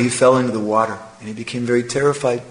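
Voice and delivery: ordinary delivery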